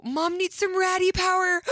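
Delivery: high, loud sing-song call for hugs; mock-urgent plea, voice jumping up high; playful loud call. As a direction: call out to your son for a hug, hammed up. Mom calling son for hugs.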